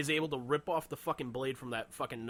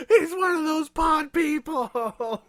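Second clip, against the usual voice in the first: high, very loud, drawn-out cry; hammed-up sci-fi movie alarm; mock horrified shout; 10 dB louder, much slower than usual. Bystander denouncing the customer as an alien, mock horrified shout.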